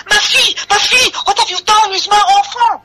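very high, frantic French mother; frantic shrieking mother. Acted. Mother demands her missing child, frantic shriek. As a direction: wail it high as an accusation.

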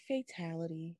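very soft, low mumble; Liu Kang, low energy. Retold or imitated. Critic mimicking how the actor said it, low-energy mumble. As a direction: say the big line with zero energy. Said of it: "I wanted some energy in that shit".

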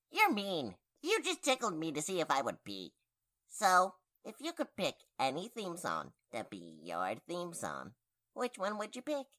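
ordinary delivery

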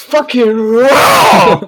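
slow, drawn-out, high shout. Retold or imitated. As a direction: bellow it like a furious chef. Quoting the Kitchen Nightmares chef.

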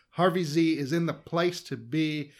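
ordinary talk

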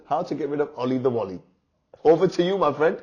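ordinary delivery

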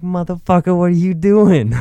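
high, loud incredulous yell; exasperated shout. Retold or imitated. Finding drunk friend half under the car, high exasperated shout.

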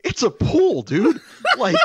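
high, loud laughing exasperation; laughing disbelief. Real, not acted. Complaining to Dan about the pool, high laughing exasperation.

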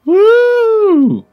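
a high loud whoop of delight; excited whoop. Real, not acted. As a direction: whoop like a fan spotting a star. Excited at seeing [name].

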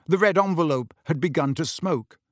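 ordinary delivery, nothing marked

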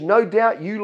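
ordinary talk

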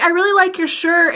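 high fake-sweet salesperson voice; salesperson gushing. Mall salesperson flattering her shirt, high fake-sweet voice. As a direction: put on a high sugary sales pitch. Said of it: "Or they'll be like".

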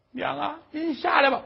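high-pitched coaxing plea; pleading son. Acted. Son begging his mother to climb down from the roof, pleading. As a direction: call up to her imploringly.

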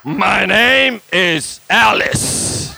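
loud, very slow demon impression. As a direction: stretch every word as a possessed woman's demon. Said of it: "what's your name?".

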